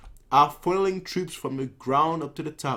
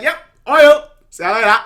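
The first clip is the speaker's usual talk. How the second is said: cocky Kanki; 10 semitones higher, 12 dB louder than usual. Retold or imitated. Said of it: "He's like".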